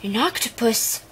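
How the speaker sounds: ordinary delivery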